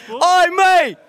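loud, high indignant shout; calling out. Real, not acted. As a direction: holler it after the heckler. Calls after the man heckling him.

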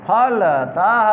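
फलतः said slowly with a rise; slow rising reading of फलतः; slow repeat. Shown as a demonstration. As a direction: read फलतः again, slowly. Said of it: "teacher repeats word slowly".

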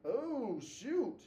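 loud, high "oops" moment; sudden dismay. Real, not acted. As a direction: exclaim on spotting your own slip. Teacher reacts to spotting a mistake.

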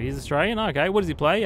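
loud, rising disbelief; excited loud questions; surprised. Real, not acted. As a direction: react as if that's news to you. Surprised about another player.

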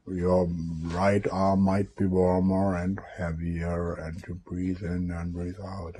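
mimicking a trance induction, soft and low; hypnotic induction. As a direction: drop into a quiet, lulling hypnotist's patter.

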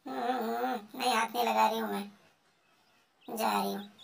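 ordinary talk, nothing marked

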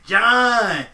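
mock drawn-out John call; calling John like Seth Rollins; lightly voiced Seth calling John; imitating Seth. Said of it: "he had to do with John saying".

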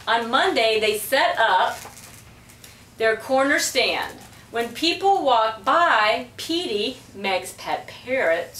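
plain reading voice

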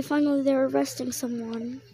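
usual speaking voice

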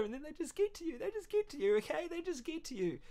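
high, soft, choked-up voice; overcome, repeating it in a small high voice; tearful. Real, not acted. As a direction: let it climb and soften as emotion takes over. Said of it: "I'm crying right now".

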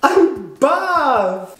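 slow, high, drawn-out brag; milk every word, pitched high; hammed-up strongman boast. Bragging after breaking the lemon squeezer, hammed-up strongman boast.

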